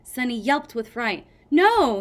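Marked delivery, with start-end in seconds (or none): 1.5-2.0 loud, high call; loud call to stop her. A narrator's reading. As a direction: call out across the cave. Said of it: "Tsunami called".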